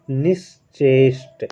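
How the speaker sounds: निश्चेष्ट slow, with a half palatal sh after ni, nish-cheshT; slow teacher demo of निश्चेष्ट; careful spelled-out demo. Shown as a demonstration. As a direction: draw out निश्चेष्ट slowly so the half sh is heard. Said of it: "तालव्य सा, वो भी आधा".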